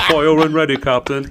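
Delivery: high, loud British command; excitable British naval bluster; cocky British officer. Acted. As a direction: shout the order as a hammed-up British general. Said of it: "There's a couple different captains".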